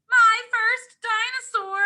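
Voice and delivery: big storybook excitement; excited. Acted. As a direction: announce the first dinosaur with glee. Excited reading of dinosaur page.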